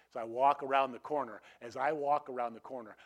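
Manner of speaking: normal talking voice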